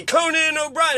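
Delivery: high-pitched mock announcer call; announcer intro. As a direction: announce the star to whip up cheers. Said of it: "And then say".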